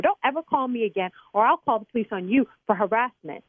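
ordinary talk, nothing marked

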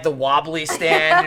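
normal talking voice

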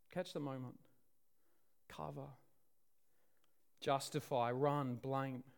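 very soft, low, one word at a time; hushed solemn list. Real, not acted. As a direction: let each word drop into a long silence. Listing the aftermath of the fall.

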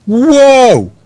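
loud, high, drawn-out whoa; big awestruck whoop, acted out; crowd's amazed whoa. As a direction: imitate an astonished audience crying out. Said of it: "everyone, like, goes".

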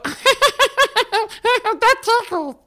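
high squeaky giggling toy voice; Tickle Me Elmo voice. Retold or imitated. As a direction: giggle then squeak it out slowly like a tickled doll. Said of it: "Did you just play that from a phone or something?".

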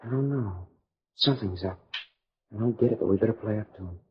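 soft, confidential undertone; hushed warning. Acted. Young man secretly warning his girl, hushed warning. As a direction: keep it down so the hosts can't hear.

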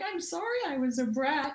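high, sheepish mock apology; lightly high-pitched sorry. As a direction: play the sheepish kid saying sorry. Retold apology, mock childish.

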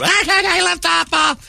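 slow, very high falsetto; giggly softball girl. Acted. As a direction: squeal it like a carefree young girl.

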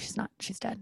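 deadpan whispered joke; mock whisper. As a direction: whisper it like a secret. Joking whisper about dog.

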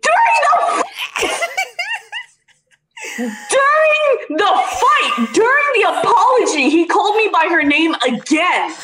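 outraged disbelief. Real, not acted. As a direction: stretch each word in shrieking disbelief. Outraged disbelief, telling the offence.

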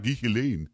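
GHISLAINE with an audible H after the G; mimicked H pronunciation. Host mimics co-host pronouncing an H, exaggerated H in GHISLAINE. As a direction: mimic GHISLAINE as gee-HEE-lane, sounding the H. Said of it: "you're pronouncing an H. You're like".

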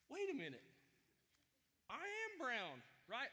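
high, loud wait-a-minute discovery; sudden realization. Retold or imitated. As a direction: play the kid finally getting it, half laughing. Sudden realization of being adopted.

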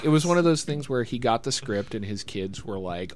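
ordinary talk, nothing marked

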